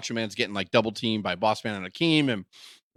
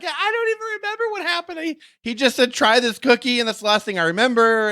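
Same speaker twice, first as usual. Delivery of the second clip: very high put-on female voice; mock woman's voice; 11 semitones higher than usual. Manager explaining herself to angry wrestler, mock female voice. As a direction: pitch it way up, playing bewildered. Voicing bewildered Elizabeth.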